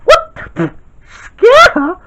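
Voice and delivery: can't believe the suggestion; shocked disbelief. Acted. Shocked by dad's idea.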